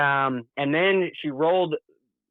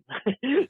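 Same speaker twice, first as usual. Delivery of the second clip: laugh; 10 semitones higher than usual. Real, not acted. Laughing at helicopter surprise.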